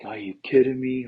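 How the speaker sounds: the friend groaning over the dropped chick; a light retold "are you kidding me" of frustration; mock frustrated. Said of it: "super frustrated".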